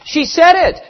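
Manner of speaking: high, loud excited cry; yelling from shore. A narrator's reading. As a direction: shout the news out urgently. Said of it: "several from the shore yelled".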